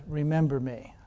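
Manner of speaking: soft prayer. Shown as a demonstration. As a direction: say it softly, as a humble prayer. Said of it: "That's a good way to pray".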